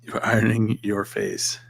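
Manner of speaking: slow hushed whisper; whispered quote. Retold or imitated. Friend repeats what Scott said, slowly in a whisper. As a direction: slowly whisper the odd phrase. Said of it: "Scott was like".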